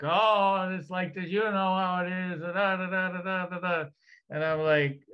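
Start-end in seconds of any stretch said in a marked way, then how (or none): 0.0-3.9 higher, whiny mock lament; Irish victim story. Mocking the Irish as always telling a sorry tale, mildly whiny. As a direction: play up the poor-me complaint, lightly mocking. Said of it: "they're always like".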